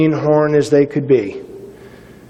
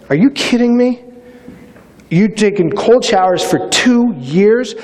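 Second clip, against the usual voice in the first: disbelieving; 5 semitones higher than usual. Retold or imitated. Officer's disbelief quoted.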